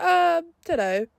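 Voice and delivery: high, slow, evasive mumbling imitation; mock clueless guys. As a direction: play dumb in a high, drawn-out voice. Guys' evasive answer, mimicked.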